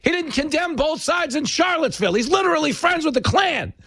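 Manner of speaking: high-pitched hammed-up street heckler; mock Democrats crying scandal like hustlers; mock accuser. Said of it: "started walking down the street going".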